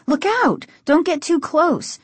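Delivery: high-pitched, urgent caution; sudden high cry of warning. A narrator's reading. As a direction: warn someone back in alarm. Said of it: "she warned".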